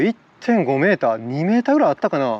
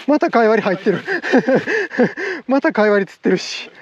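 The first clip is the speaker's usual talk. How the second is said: higher voice, breaking into laughs; real amused laughter; 7 semitones higher than usual. Real, not acted. Angler finds yet another fish in the catch, laughing. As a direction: crack up while talking.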